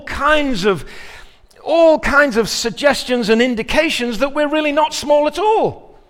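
loud, high, building to a shouted question; preaching at full force. Acted. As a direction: press the congregation hard, full voice.

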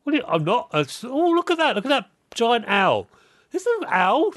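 high, flustered mock distraction; mock distracted player. Player dodging a question by pointing at an owl, mock flustered high voice. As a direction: squeak out a fake distraction.

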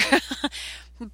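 short breathless laugh; laughing at her own gun remark; airy laugh. Real, not acted. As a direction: let out a quick airy chuckle.

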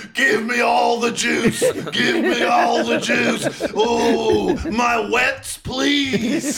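over-the-top frantic demand; manic mock yell. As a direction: beg wildly in a hammed-up high holler. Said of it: "started slapping his own face, going".

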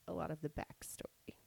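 soft whispered tease. Real, not acted. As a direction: whisper it ominously. Whispered aside.

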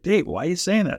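mock-baffled, pitch lifted well up; puzzled protest. Acts out dad's protest in story.

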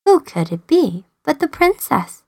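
usual speaking voice

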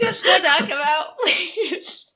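pleading through real laughter; laughing plea. Real, not acted. Player laughing while begging her friend to attack. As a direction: laugh out the request to knock him out.

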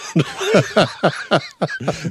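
high, delighted cackle at the joke; burst of laughter. Real, not acted. Reacting to a joke about accents.

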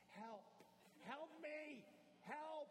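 high desperate cry for help; high, loud mock-panicked cat pleading. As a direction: squeal like a cat trapped by a toddler. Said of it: "and the cat is going".

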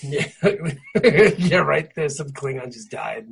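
normal talking voice

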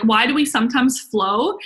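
ordinary talk, nothing marked

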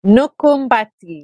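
"Nukumbati" slow, with a clear M before bati, Fijian accent. Shown as a demonstration. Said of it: "she's throwing that Fijian accent in there".

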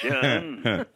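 lightly voiced old grouch; a hint of a cranky old man; grumpy old man. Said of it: "I'm an old man again".

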